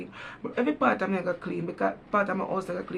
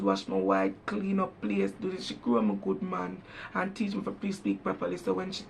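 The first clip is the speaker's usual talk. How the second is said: ordinary talk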